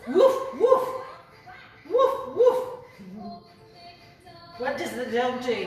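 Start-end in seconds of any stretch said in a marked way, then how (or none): 0.1-2.7 loud, high rising woofs; dog bark. Pretend. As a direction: lead the children in barking. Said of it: "make a sound of a dog".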